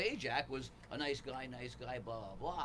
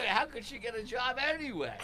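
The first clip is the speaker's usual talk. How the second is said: high, louder mocking jab; snide add-on; 10 semitones higher, 8 dB louder than usual. Comic mocking the heckler to the crowd. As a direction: toss off a cruel aside. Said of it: "You go".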